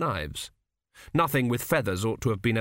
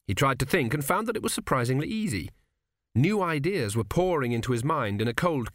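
ordinary delivery

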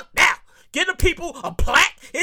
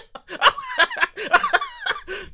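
high cackle. Real, not acted. Host laughing at his own joke, high cackle. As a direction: crack up at the joke.

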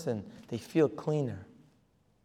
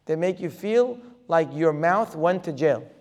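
ordinary talk

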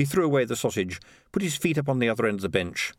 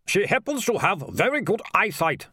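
high-pitched snappy retort; snapping; 9 semitones higher than usual. A narrator's reading. Mayor defends her to sergeant, snapping. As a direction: snap back in a high mayor's voice. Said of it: "snapped the mayor".

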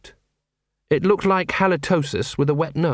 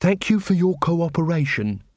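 gravely; 4 semitones higher than usual. A narrator's reading. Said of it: "he said gravely".

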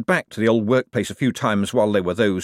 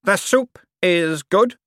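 slow, higher, put-on voice; character voice; 10 semitones higher, 4 dB louder, slower than usual. A narrator's reading. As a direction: savour the soup in a slow put-on voice. Character line in audiobook.

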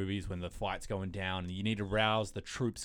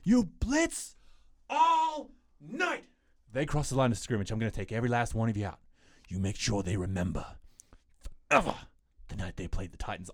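rousing coach speech. Retold or imitated. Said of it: "He's like".

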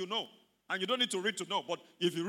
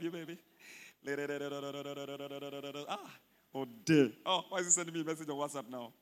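much lower, soft, fast asides; mock distracted prayer; 9 semitones lower, 5 dB quieter than usual. Pastor acting a member reading WhatsApp in prayer, low murmur. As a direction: mimic someone texting during prayer. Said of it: "Praying and checking the time".

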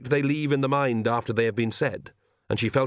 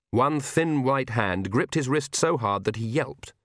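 ordinary delivery, nothing marked

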